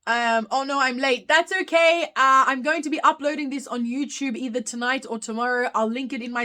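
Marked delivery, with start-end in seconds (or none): none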